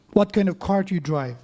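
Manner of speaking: ordinary delivery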